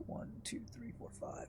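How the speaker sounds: barely audible count under the breath; whispered counting. Real, not acted. Player tallying puzzle letters for themself, whispered. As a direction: whisper the numbers to yourself.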